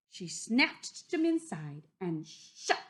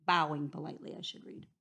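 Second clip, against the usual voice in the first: usual speaking voice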